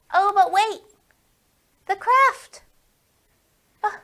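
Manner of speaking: loud surprised exclamation; sudden remembering. Acted. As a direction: burst out as if you nearly forgot. Suddenly remembering craft for kids.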